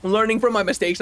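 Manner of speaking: ordinary talk, nothing marked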